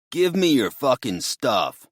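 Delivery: loud menacing thug voice; threatening thug. Acted. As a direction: bark it like a junkie robber. Axe-wielding robber demands stuff.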